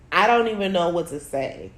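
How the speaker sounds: usual speaking voice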